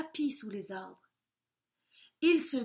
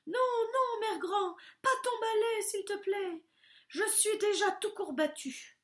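much higher whining plea; pleading wolf; 10 semitones higher than usual. A narrator's reading. Wolf begging the witch to spare him. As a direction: beg in a squeaky whine.

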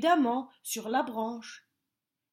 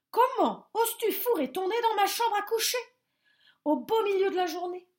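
higher, louder, fast scolding; very cross owl; 9 semitones higher, 5 dB louder, faster than usual. A narrator's reading. Owl scolding the bear for waking it, higher and louder. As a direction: tell him off hard. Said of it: "très fâché".

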